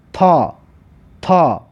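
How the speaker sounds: "تا" as a heavy, backed "ta"; emphatic ta. Shown as a demonstration. Teacher models Arabic ta with fatha, "تا" as heavy "ta". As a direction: say "تا" with the tongue pulled back.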